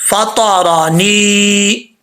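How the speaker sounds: फातारानी drawn out very slowly; slow recitation. Shown as a demonstration. Teacher reciting the word slowly for the class. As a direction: stretch each syllable of फातारानी. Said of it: "recitation demo".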